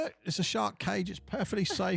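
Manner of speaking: mock reassuring voice. Mock reassurance in shark story.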